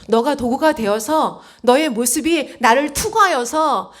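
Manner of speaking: slow ringing exhortation; impassioned appeal. Retold or imitated. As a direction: press each phrase out forcefully. Impassioned appeal in Jesus's words.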